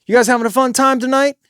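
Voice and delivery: a little extra. Shown as a demonstration. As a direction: talk just above conversation level, quick. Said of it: "It's a little bit extra".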